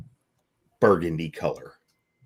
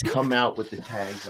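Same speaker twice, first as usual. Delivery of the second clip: ordinary talk, nothing marked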